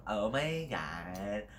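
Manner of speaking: put-on slow, drawn-out Valley Girl drawl. Shown as a demonstration. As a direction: stretch it out like a gossiping Valley Girl. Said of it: "There's the Valley Girl".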